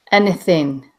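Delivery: a hint of British in it; slight British accent. Woman quoting the girl's one British-sounding word, slight British accent. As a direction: say it with a light British lilt. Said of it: "one word with a little British".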